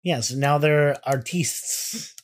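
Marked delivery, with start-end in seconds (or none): 1.1-2.1 "artistes" drawn out with stress on the end; mock-French artistes. Co-host teasing band as now artistes, mock-French ending.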